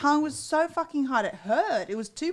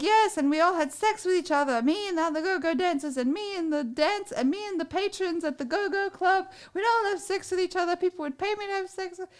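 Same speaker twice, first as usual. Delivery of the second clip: a light, higher put-on Italian accent; hint of an Italian accent, pitched up; 7 semitones higher than usual. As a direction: lightly voice the Italian guy bragging. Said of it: "I love the Italian accent".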